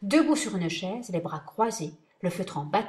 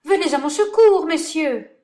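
panicked plea; 9 semitones higher, 11 dB louder than usual. Acted. Actor begs for help.

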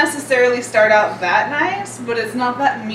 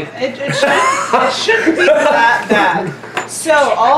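laughing; 3 semitones higher, 4 dB louder, slower than usual. Real, not acted. Laughing while reassuring friends.

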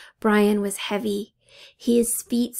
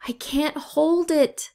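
higher, strained cry of effort; straining yell; 6 semitones higher, slower than usual. A narrator's reading. Brian yells to the others while straining at the door. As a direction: shout it while holding the door shut. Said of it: "Brian yelled".

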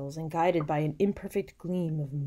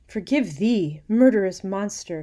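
loud, raised dramatic outburst; anguished cry; 4 semitones higher, 11 dB louder than usual. A narrator's reading. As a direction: cry out against yourself. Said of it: "cried Manfred".

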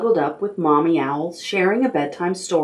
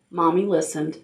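ordinary delivery

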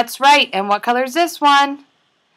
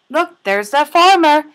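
usual speaking voice

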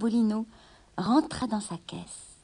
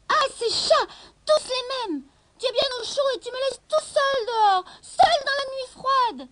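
whining goblin; 13 semitones higher, 4 dB louder than usual. A narrator's reading. Goblin whines outside window.

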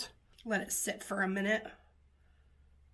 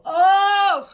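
loud, high "oh" of amazement; big high-pitched exclamation; excited outburst; 10 semitones higher, 14 dB louder than usual. Real, not acted. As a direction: cry out at a dazzling result. Startled delight at highlighter shine.

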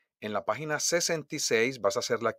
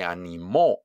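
"animó" stressed on MÓ at the end; stress on final O; 3 semitones lower, 3 dB louder than usual. Shown as a demonstration. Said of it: "la última tiene acento en la O". ("animó" acentuado en la O final)

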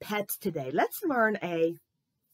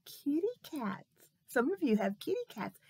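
normal talking voice

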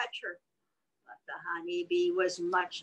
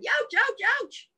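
high yelping bear cry of pain; a hammed-up howl of pain, pitched high; 13 semitones higher than usual. A narrator's reading. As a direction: yelp it high like a stung bear. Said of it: "He howled".

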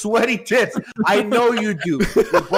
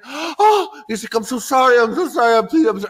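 panicked apology; 7 semitones higher than usual. Retold or imitated. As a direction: stammer an apology to a giant man.

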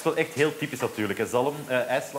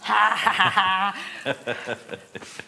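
loud laugh; 7 semitones higher, 4 dB louder than usual. Real, not acted. Host bursts out laughing.

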